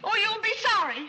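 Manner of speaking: high, loud sulky threat; childish threat. Acted. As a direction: threaten him like a spoiled child. Spoiled girl threatens butler.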